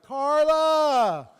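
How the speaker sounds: loud, high drawn-out holler; yelled call. Retold or imitated. Failing comic calls to his girlfriend at the bar, yelled. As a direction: shout the name out across a room. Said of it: "he yells out".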